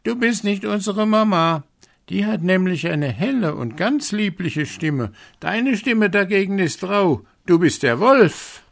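put-on goat kids calling. A narrator's reading. Little goats refusing the wolf, high and loud. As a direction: shout it like defiant little kids. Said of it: "riefen sie".

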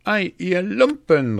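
high, loud cry of abuse; indignant calling out. A narrator's reading. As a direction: curse the cheats who got away. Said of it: "rief er".

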